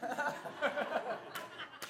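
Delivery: quiet breathy laugh; little rueful laugh; soft laugh. Real, not acted. Laughing at the mishap.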